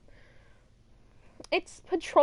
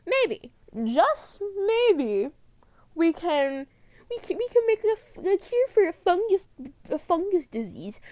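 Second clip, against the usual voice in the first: mocking high-pitched impression of a fool; Marlene; 6 semitones higher, 3 dB louder than usual. Fan mocks dumbass Marlene's cure idea, mocking impression. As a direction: drag out the hopeful maybe, high and silly. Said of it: "the dumbass herself, who thought".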